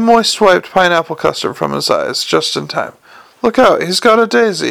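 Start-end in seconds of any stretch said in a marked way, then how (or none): 3.4-4.7 a raised, high warning cry; alarmed shout. A narrator's reading. Moist warns the room about the clown's daisy, shouting. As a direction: yell the warning across the room. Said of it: "he shouted".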